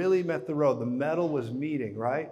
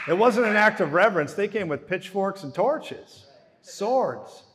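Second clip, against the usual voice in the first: fired-up preaching; 6 semitones higher, 6 dB louder than usual. Real, not acted. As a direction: preach it hard, building the picture. Preaching climax about the soldiers.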